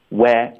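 "where" cut to an open "weh", jaw dropped; open-mouthed vowel. Shown as a demonstration. Teacher showing the first half of wait, wide open "where". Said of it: "一定要张嘴".

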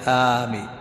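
"aameen" with its first vowel held; long alif in "ameen". Shown as a demonstration. Teacher showing variant forms of the word, long-alif "aameen". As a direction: stretch the opening aa of "aameen". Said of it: "فيها، فيها لغات".

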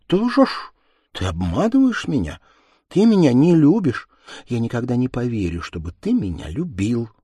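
put-on high, loud, hurt accusation from a girl; young woman's accusing cry. Ганна accuses her lover of lying, high and loud. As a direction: fling it at him as a wounded girl.

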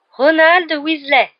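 high, loud, drawn-out name; summoning call from above. A narrator's reading. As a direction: call the next pupil down slowly. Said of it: "dit la voix familière au-dessus".

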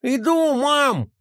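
answering through a mouthful of pie; child with mouth full. A narrator's reading. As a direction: call out with your mouth full. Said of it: "ответил он с набитым ртом".

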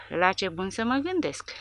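ordinary delivery

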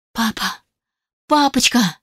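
breaking into sobs, rising on Папочка; tearful cry. Acted. Alisa sobs to her adoptive father on the phone, tearful. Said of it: "я разревелась прямо в трубку".

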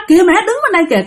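put-on high-pitched child calling mom; four-year-old child. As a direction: pipe up to warn mom. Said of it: "đứa con của cổ lúc đó là bốn tuổi". (giọng bé bốn tuổi gọi mẹ, cao)

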